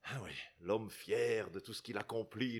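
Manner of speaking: ordinary talk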